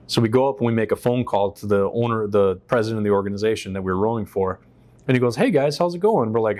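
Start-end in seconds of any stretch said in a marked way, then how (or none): 5.4-6.3 high, chirpy mock hello; imitated breezy greeting. Organization president greeting rescued rowers by phone, imitated breeziness. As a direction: mimic an oblivious, cheery caller.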